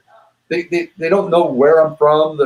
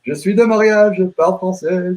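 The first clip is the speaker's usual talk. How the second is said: Québécois French; 6 semitones higher than usual. Shown as a demonstration. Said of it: "You, you don't sound like you're from Montreal".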